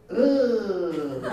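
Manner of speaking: mock disgusted grunt; a short repulsed ugh. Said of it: "this is a quote".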